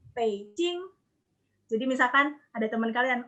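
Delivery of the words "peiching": "Beijing" as pei-ching, split in two syllables; Mandarin pronunciation. Shown as a demonstration. Teacher repeating the correct reading, Mandarin pronunciation. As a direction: sound "Beijing" with p and ch. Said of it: "Jadi dibacanya".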